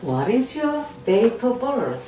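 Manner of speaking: very slow, every word laid out; slow model reading. Shown as a demonstration. Teacher to Korean learners, slow model reading. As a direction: spell it out slowly for learners. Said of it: "teacher models English question".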